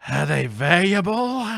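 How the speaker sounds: loud, high, drawn-out question; slow, overblown impersonation; character voice. Acted. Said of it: "That's his voice, all right?".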